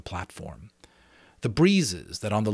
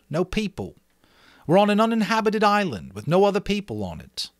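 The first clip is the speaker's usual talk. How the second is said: put-on higher, louder boy's voice announcing news; Ralph addressing the boys; 9 semitones higher, 5 dB louder than usual. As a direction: speak up like a boy taking charge of a meeting. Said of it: "and spoke".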